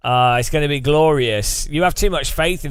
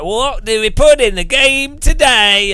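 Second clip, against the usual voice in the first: high, loud mock designer voice; big, excited boss announcement, hammed up; Marco; 9 semitones higher, 6 dB louder than usual. Designer hits the random feature board, mock-excited cry. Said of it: "and he's like".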